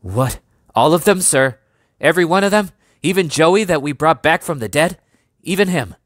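Albert crying out across the yard to the major; ringing out. A narrator's reading. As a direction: let it ring out in disbelief. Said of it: "Albert's voice rang out across the yard".

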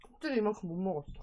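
words muffled by chewing; talking while chewing. Real, not acted. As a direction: mouth partly full of food. Seen 0: Bites chicken just before; lips closed, jaw working, chicken in hand.

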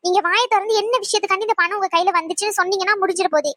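usual speaking voice

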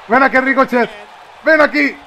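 high, loud taunting call; calling out a challenge. Real, not acted. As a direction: shout for the opponent to come over. Calling an opponent in the game. (llamada desafiante a gritos)

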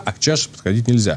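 normal talking voice

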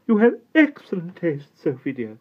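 high, loud teasing duchess; grand duchess. A narrator's reading. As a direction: tease the girl fondly. Duchess teases Sophie.

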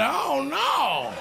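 mock alarm. Shocked at what she's doing.

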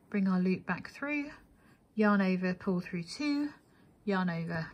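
ordinary talk

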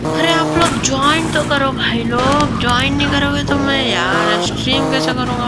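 pleading. Real, not acted. Pleading viewers to join stream.

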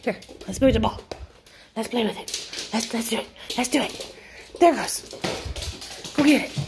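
high sing-song voice for the dog; talking to a puppy. Real, not acted. Playing with puppy, baby talk.

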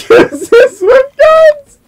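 high, slow pun through laughter; laughing punchline. Shown as a demonstration. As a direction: crack up while repeating the joke. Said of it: "Get it?".

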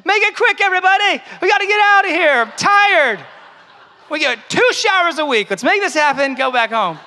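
high, loud hammed-up shout; freezing-cold hurrying. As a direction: hurry everybody along like you're freezing. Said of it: "all the kids freezing, like".

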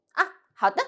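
short falling "啊" then 好的; agreeing ah. Shown as a demonstration. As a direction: agree briskly. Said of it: "So agreement".

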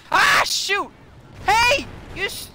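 high, loud outburst; startled shout. Real, not acted. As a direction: shout out in alarm. Attacked in the game.

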